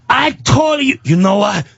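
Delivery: high mock-angry shout; angry yelling. Pizza man loses patience with neighbor, high angry mock shout. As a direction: imitate a furious neighbor losing it. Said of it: "stop yelling".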